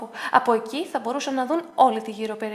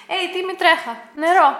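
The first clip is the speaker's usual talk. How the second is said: loud, higher call to the dog; 6 semitones higher, 8 dB louder than usual. A narrator's reading. Dick calling the dog to the spring, loud call.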